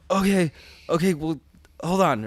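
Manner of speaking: loud, high-pitched flustered stalling; mock panic. Husband on the phone after the news, loud mock panic. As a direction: act out scrambling to take control.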